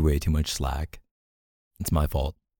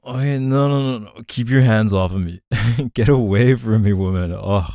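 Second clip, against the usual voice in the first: playful protest; 4 semitones higher, 6 dB louder than usual. Real, not acted. Playful fending off, louder.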